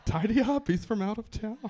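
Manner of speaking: fussy host. Retold or imitated. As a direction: play the flustered host tidying for a guest. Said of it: "You're like".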